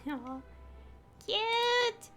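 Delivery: usual speaking voice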